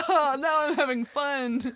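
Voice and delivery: high, laughing sugar-high giddiness; half-laugh it like a sugar rush; mock giddy laugh.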